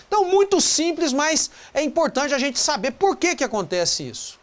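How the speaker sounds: ordinary talk, nothing marked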